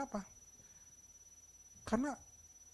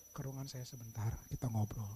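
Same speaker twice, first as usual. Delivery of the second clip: low, soft invitation to talk; quiet, unthreatening summons; gentle boss; 11 semitones lower, 8 dB quieter than usual. Shown as a demonstration. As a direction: invite the employee in calmly, voice dropped. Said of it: "tapi beda caranya".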